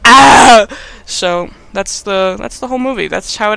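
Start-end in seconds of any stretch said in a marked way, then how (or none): 0.0-0.7 loud, high, drawn-out scream; hammed-up scream of despair; mock scream. Adam shut in the dark, loud acted scream. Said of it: "Adam sits there screaming in the dark".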